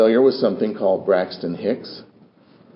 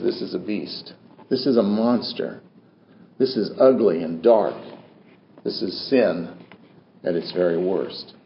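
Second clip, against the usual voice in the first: low, much softer, solemn judgment; grave hushed verdict; 5 semitones lower, 6 dB quieter than usual. Retold or imitated. God's verdict voiced by preacher.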